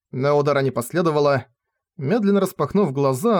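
plain reading voice

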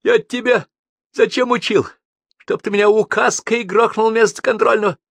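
high, loud, plaintive complaint; tearful whine. A narrator's reading. Teacher reproaching his old pupil, tearful whine. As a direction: complain in a loud whining wail. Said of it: "сказал он плаксивым голосом".

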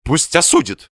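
high-pitched defiant retort; stubborn husband's declaration; resolute. A narrator's reading. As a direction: throw it back decisively. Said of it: "решительно сказал Васисуалий".

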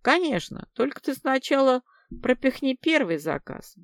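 high, readily coquettish reply; coquettish, restrained irony. A narrator's reading. Людмила bargaining with her caller, coquettish and faintly ironic. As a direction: agree flirtatiously with a touch of irony. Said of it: "с готовностью кокетством и сдержанной иронией ответила".

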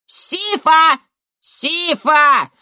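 put-on high, loud children's jeer; taunting yell. A narrator's reading. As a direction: shout it as a playground taunt. Crowd of children chanting taunt. (дразнящий крик детворы)

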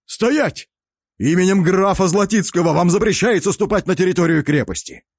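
high, strained official yell; strained shout. A narrator's reading. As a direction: bark the order, straining. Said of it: "Натужно прокричал усач".

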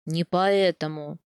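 хмуро и медленно, ниже обычного; поправить с недовольством, неторопливо. A narrator's reading. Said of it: "хмуро поправил меня Аяс". (хмуро поправляет тёщу, медленно)

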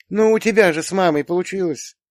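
high, teasing daughter; sly. A narrator's reading. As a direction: needle dad in a high girl's voice. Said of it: "Доча коварно заулыбалась". (с хитрецой, высоким голосом дочки)

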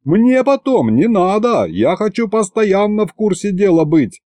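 high-pitched, heated demand; worked-up, high protest; indignant yelling. A narrator's reading. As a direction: insist hotly, voice up high. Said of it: "заорал я так".

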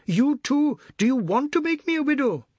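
high, wailing reproach; anguished high-pitched protest; distraught woman. A narrator's reading. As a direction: plead in a high, loud voice. Woman pleads with the boy.

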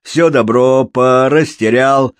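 loud, slow chanting rhyme; loud showman's verse; rhyming showman. Acted. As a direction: call it out like a street puppeteer. Puppeteer calls out his rhyme.

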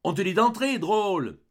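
high, loud scolding order; angry shout. A narrator's reading. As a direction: order the child in crossly. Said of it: "cria du fond de la cahute avec colère".

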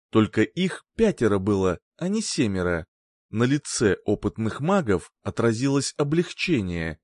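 usual speaking voice

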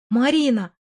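distressed call of the name; mildly lamenting cry; wailing plea. A narrator's reading. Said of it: "запричитала женщина".

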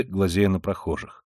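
usual speaking voice

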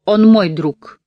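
loud, firm declaration. Acted. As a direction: say it with resolve. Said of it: "твёрдо сказала она".

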